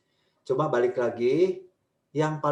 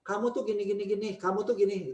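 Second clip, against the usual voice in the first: accusing; 7 semitones higher than usual. Shown as a demonstration. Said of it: "jangan kita ngomong bahasanya bahasa kamu".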